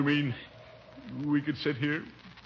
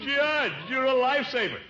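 high-pitched grateful holler; relieved calling out; 11 semitones higher than usual. Acted. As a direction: shout up to your rescuer in amazement. Calls up to rescuer.

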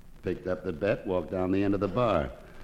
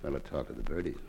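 normal talking voice

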